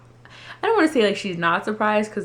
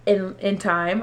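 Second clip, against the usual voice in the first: normal talking voice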